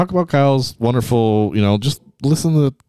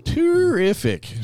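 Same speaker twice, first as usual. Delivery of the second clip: slow, drawn-out deadpan; deadpan sarcastic; 10 semitones higher, much slower than usual. Acted. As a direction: stretch it out sarcastically.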